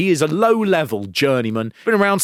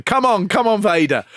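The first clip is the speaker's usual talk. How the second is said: retold shout; 7 semitones higher than usual. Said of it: "he starts shouting".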